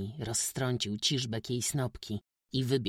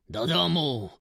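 loud, sharp order home; firm loud command; said loudly; 6 semitones higher, 8 dB louder than usual. A narrator's reading. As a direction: bark it out like a stern old father. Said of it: "powiedział głośno".

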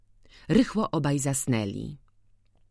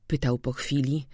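plain reading voice

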